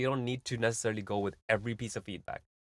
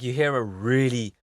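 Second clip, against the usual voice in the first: ordinary talk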